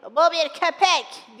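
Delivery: loud, slow cry from the audience; acted shout. Spectator in the back rows pointing out a dog, shouting. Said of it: "diye bağırdı".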